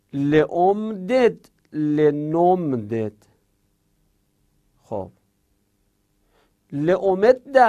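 drilling the negatives for students; paradigm recitation. Shown as a demonstration.

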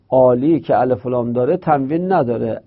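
ordinary delivery

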